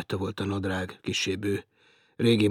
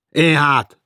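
louder gruff answer; shouted reply; 6 semitones higher, 11 dB louder than usual. Acted. Félix posing as Martin, loud reply. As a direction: shout it back down.

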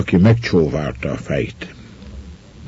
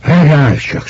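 high, louder angry command; growing anger; 9 semitones higher, 5 dB louder than usual. A narrator's reading. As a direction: order someone to stop, crossly. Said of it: "suszogta neki mérgesedve". (mérgesen, emelt hangon)

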